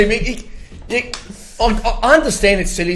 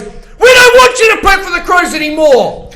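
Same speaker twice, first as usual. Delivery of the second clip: fired-up rant; 8 semitones higher than usual. Real, not acted. Crows fan shouting at departing player, angry. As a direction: tell him to get out, shouting.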